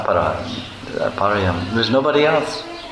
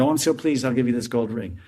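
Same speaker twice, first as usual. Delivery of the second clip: pleased mother-in-law; 3 semitones higher, 5 dB quieter than usual. Retold or imitated. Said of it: "she said".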